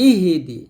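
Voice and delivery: "ইহি দি" with a vowel after h; "ইহি দি" run on as three syllables; mistaken added vowel. Shown as a demonstration. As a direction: voice an extra i in "ইহি". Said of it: "আমরা এই হাটা ইহি বলি".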